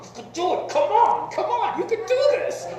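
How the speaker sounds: high excited crowd cheer with a laugh; root for the team, voice jumping way up; imitating fans yelling encouragement from the bleachers. Crowd cheering at basketball game.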